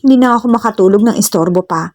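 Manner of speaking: ordinary delivery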